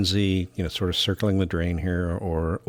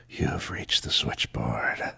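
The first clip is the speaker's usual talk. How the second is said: slow, deep husky phone greeting; deep switchboard operator voice; 7 semitones lower, 3 dB quieter, much slower than usual. Pretend. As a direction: drop low and slow like a phone line. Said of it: "husky morning voice".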